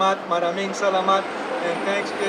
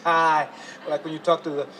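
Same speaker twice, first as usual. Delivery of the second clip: ordinary talk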